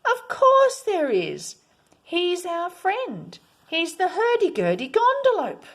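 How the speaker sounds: put-on children insisting, called out; children calling out. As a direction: call it out to the grown-ups, firm and proud. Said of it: "called out and said".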